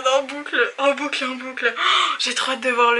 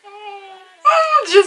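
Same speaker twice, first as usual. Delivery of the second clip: excited; 9 semitones higher, 8 dB louder than usual. Real, not acted. Reacting to trailer.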